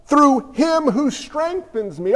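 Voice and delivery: "Through him who strengthens me" slowly, each word weighed; slow, deliberate reading. Acted. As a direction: finish the verse slowly and solemnly. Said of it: "let me keep reading".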